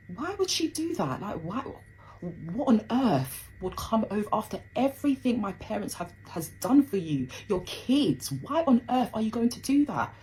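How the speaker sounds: high-pitched, exasperated questioning; retold indignant disbelief. Daughter silently questioning her cousin's accusation, retold indignant disbelief. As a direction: re-enact reeling at a betrayal.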